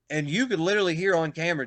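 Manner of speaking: normal talking voice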